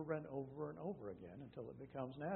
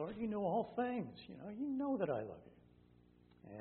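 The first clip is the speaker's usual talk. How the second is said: higher, drawn-out plea of a worn-out Peter; exasperated; 8 semitones higher, slower than usual. Retold or imitated. Peter answering Jesus the third time, exasperated. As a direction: let Peter's patience wear thin, slowly. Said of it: "he's kind of exasperated".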